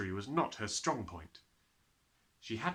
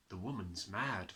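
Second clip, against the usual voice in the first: muttered to herself; 4 semitones lower, 8 dB quieter than usual. A narrator's reading. Miss Honey thinks to herself.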